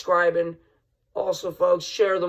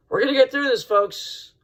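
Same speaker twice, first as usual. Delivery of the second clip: rallying cry; 8 semitones higher than usual. Real, not acted. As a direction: lift them up with a fired-up promise. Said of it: "rallying the viewers".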